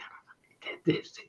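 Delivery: under-breath whisper of disbelief; whispered aside. Retold or imitated. Sitting on Zoom, muttering about being introduced, whispered. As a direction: whisper it to yourself, stunned. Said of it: "And I'm sitting there going".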